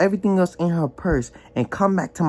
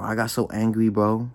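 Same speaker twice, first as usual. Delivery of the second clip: normal talking voice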